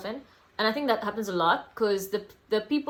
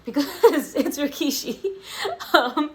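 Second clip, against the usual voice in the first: laughing; 6 semitones higher, 4 dB louder than usual. Real, not acted. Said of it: "I know why I didn't say the name".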